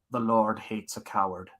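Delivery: light impression of a film mentor; a hint of a Scottish star's delivery; Sean Connery. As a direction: lightly voice a remembered movie line in character. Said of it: "when Sean Connery is asked about".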